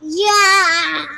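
very loud, drawn-out cheer; full-volume shout on cue; enthusiastic yeah. Shown as a demonstration. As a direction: belt out an eager "Yeah!". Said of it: "Where's my enthusiasm? Say".

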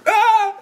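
high laughing shriek, half-crying; mock scream. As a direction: let out a fake scream while laughing. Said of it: "just scream".

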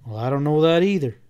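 ordinary delivery, nothing marked

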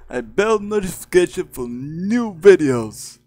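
high, loud cartoon sign-off; [name]. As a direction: call out to subscribers high and loud. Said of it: "I [name]".

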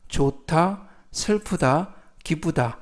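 ordinary talk

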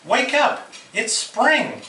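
high, eager wake-up call; Frog shouting. A narrator's reading. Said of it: "shouted Frog".

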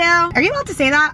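ordinary talk

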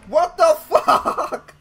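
loud, slow, high laughing; laughing shock. Real, not acted. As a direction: stretch it out, cracking up.

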